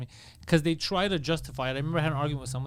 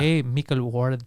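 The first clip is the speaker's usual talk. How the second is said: mock Spanish accent; 5 semitones lower, slower than usual.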